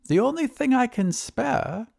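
high-pitched, pondering wizard; thoughtful wizard. Acted. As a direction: muse aloud in a high character voice. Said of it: "replied the wizard thoughtfully".

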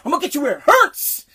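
loud, high mock threat; imitated threat. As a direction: imitate an angry boss, hitting the last word. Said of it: "he's pointing at his finger".

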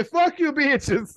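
slow, laughing through the words; drawn-out, giggling mock quote. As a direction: spit it out while cracking up. Quoting him while laughing.

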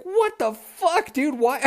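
high, drawn-out appalled cry; high, slow bewildered what the fuck; stunned outrage. Retold or imitated. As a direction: stretch it out like you can't believe it. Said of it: "I wake up and I'm like".

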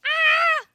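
sudden loud high shriek; startled shriek. Real, not acted. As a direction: shriek at the scare. Creepy sound effect startles her.

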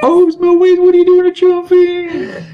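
high squeaky talking-dog voice. Retold or imitated. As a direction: play a cartoon dog. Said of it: "Wells makes the dog start talking".

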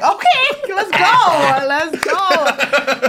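high, loud burst of eagerness; excited retold reply. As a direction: act out an eager, hyped-up yes.

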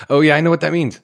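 eager, cheeky I know; knowing excitement. Real, not acted. Knowing joke about BDE.

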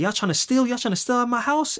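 high-pitched mock suspicion; accusing grown man. Short buff man accusing kids of stealing, high mock indignant voice. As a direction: imitate a worked-up man, questions rising high. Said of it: "grown-ass man walk up to us".

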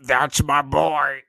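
slow, loud, puffed-up proud father voice; proud dad. Acted. Co-host echoing the dad, slow proud father voice.

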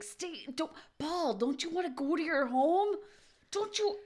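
high-pitched movie-quote impression; Adam Sandler impression. Quoting Happy Gilmore to co-hosts, high-pitched impression. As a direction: pitch it up, imitating a comic pleading with the ball. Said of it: "I thought of Adam Sandler".

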